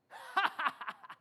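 breathy scornful laugh; mocking laugh. David laughing at the giant.

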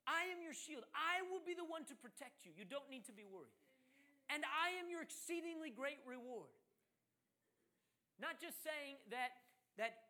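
earnest preacher's declaration; fervent preaching. Acted. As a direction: proclaim God's words with conviction. Preaching, voicing God's promises.

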